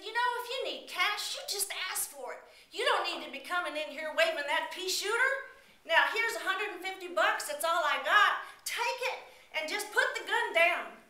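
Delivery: put-on high, loud coaxing old man; old man reasoning. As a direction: talk the gun down. Old man calms robber.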